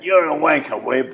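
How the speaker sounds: high, loud heckle from the back; imitating a loud heckler at the wrestling; heckling shout. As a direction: yell an insult across the hall.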